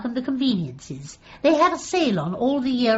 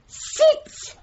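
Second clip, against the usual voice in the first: a high, forceful Sit; strong and forceful; 12 semitones higher than usual. Pretend. Rehearsing dog-training homework for a visitor, a high forceful command. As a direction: try to sound in charge of a dog. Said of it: "does that sound strong and forceful?".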